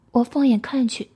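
plain reading voice

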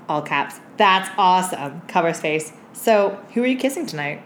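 normal talking voice

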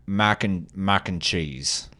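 slow British demo with broad "mac"; careful put-on British vowels; British accent. Shown as a demonstration. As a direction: say "mac and cheese" like a Brit, drawn out. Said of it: "a British person would say mac and cheese".

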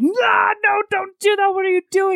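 high, loud imitation of alarm; mock panic. Hero stopping her drinking from the flask, high mock-panicked cry. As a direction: cry out frantically to stop someone.

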